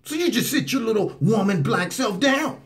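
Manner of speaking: high, hammed-up rant. Commentator mocks what the president never said, high hammed-up rant. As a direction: sneer it as the imagined bully. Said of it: "He didn't say".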